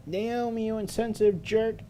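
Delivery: high, loud accusing cry; angry outburst. Acted. Seiko lashing out at Naomi, high and loud in anger. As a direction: hurl it at her friend.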